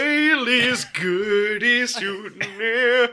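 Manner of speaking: punchy laughing nonsense. Real, not acted. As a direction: drag it out giggling. Punchy joke riff.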